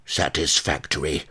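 put-on grunted by a huge old woman; grunted appraisal. A narrator's reading. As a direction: grunt it out approvingly. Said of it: "she grunted".

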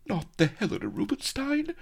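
very high, frightened quaver. A narrator's reading. Captive lady losing her precious cosmetics, quavering. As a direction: squeal it in quavering dismay. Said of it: "she quavered".

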